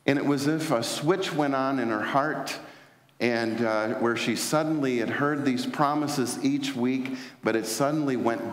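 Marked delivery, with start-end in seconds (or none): none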